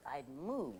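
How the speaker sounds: low muttered put-down; sullen mutter. Acted. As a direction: grumble it under your breath.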